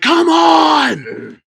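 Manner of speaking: loud, high drawn-out holler; long exasperated shout; imitated scream. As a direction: yell it out the window at someone. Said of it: "and screamed like".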